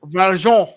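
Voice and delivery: mock French Val Jean, nasal Jean; Val Jean with a put-on French sound. Shown as a demonstration. Repeating the French name back, mock French. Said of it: "If it's from Les Miserables".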